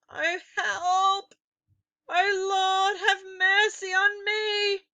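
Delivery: high, loud frightened pleading; terrified boy begging the Lord for mercy; panicked prayer. A narrator's reading. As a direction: beg for mercy in terror. Boy panics among witches.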